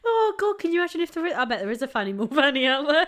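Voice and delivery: giggling. Real, not acted. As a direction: still laughing, high-pitched. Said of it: "I need to compose myself".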